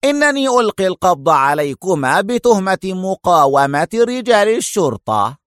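high, forceful interruption; heated official declaration; sharp. A narrator's reading. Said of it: "قاطعه المفتش في حدة".

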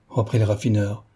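ordinary delivery, nothing marked

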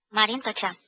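ordinary delivery

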